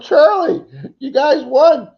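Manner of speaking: high, loud retold holler to a friend; excited yell. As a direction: re-enact yelling out the big news. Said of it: "and I yelled".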